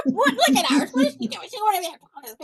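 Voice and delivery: high mock-flustered husband voice, laughing through it; imitating ex-husband. Son-in-law mimics ex-husband's bewildered muttering, high and laughing. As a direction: ham up the baffled husband. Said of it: "that's exactly what he said".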